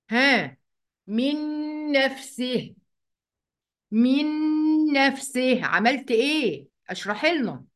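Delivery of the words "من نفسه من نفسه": "من نفسه" as minnn-nafsih, n held long; one doubled nun. Shown as a demonstration. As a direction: hum the joined n in "من نفسه". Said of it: "وهننطق نون واحدة مشددة".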